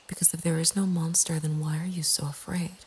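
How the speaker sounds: plain reading voice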